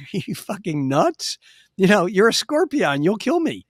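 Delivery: acted-out incredulous refusal; incredulous frog. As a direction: protest as the alarmed frog in a story. Frog balks at scorpion's request.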